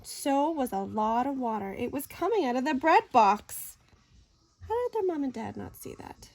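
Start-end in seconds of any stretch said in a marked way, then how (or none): none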